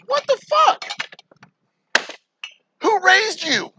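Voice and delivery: high, loud indignant cry; outraged disbelief. Real, not acted. Ranting to viewers about phone-rude customers, shocked outraged cry.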